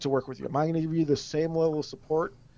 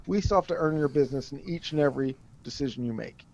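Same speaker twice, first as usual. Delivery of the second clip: ordinary talk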